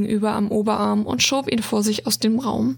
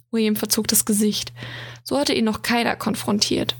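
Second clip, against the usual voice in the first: plain reading voice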